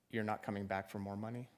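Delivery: ordinary delivery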